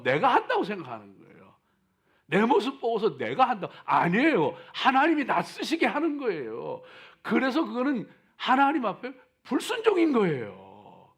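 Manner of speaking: loud, high sermon fervor; fiery preaching. Acted. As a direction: preach it out full force. Preaching at full force.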